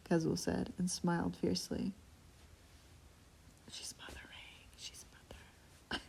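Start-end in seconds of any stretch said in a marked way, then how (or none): 3.7-6.0 very soft unvoiced aside; whisper. Real, not acted. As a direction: breathe it out as a secret.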